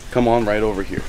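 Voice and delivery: ordinary talk, nothing marked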